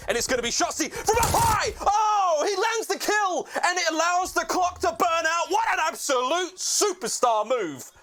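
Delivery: high, shouted play-by-play; esports caster. Real, not acted. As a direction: scream the clutch kill call. Caster calls a big kill.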